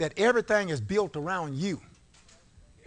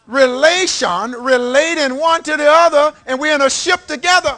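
fiery preaching; 7 semitones higher, 11 dB louder than usual. Real, not acted. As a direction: preach it with full force. Preaching at full force.

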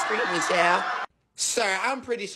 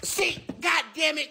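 ordinary talk, nothing marked

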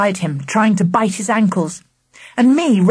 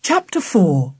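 ordinary delivery, nothing marked

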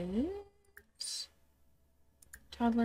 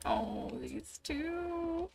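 slow, high, melting coo; 10 semitones higher, 6 dB quieter, much slower than usual. Real, not acted. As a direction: coo over something adorable. Cooing at cute game scene.

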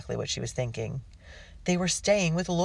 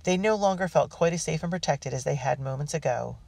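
ordinary delivery

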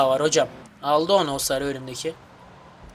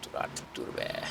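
whispered plea; 17 dB quieter, slower than usual. Real, not acted. Hushed plea.

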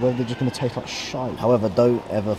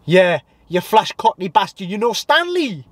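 high-pitched mock confrontation; film hooligan impression; 8 semitones higher than usual. Replaying the Football Factory scene as a high-voiced mock hooligan. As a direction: act both sides in a high, put-on voice. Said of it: "that is a classic Football Factory scene".

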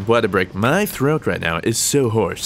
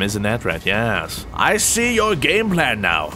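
normal talking voice

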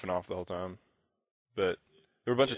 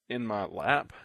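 normal talking voice

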